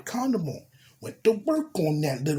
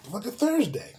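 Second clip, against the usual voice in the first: amazed; 7 semitones higher than usual. Real, not acted. Amazed at how soon.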